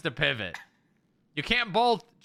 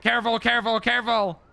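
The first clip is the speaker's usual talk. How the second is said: calling out; 6 semitones higher, 5 dB louder than usual. Real, not acted. Guiding couch, calling out warnings.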